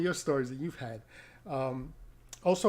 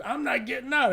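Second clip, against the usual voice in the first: much higher mock defiant drunk; higher hammed-up refusal; stubborn drunk lady; 10 semitones higher, 4 dB louder than usual. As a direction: put her foot down, tipsy and shrill-pitched. Drunk passenger refuses to leave.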